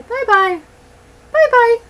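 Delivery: high, loud sing-song bye-bye; calling bye-bye. Real, not acted. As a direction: call it out to the doggy. Cheerful calling goodbye to dog.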